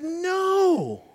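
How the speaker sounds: sudden high, squeaky no; Balaam's answer. Acted. Balaam answering his donkey, high squeaky no. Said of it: "And he said".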